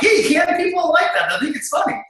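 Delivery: mock shout. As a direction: shout back at the wife that people like it. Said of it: "And I'm like".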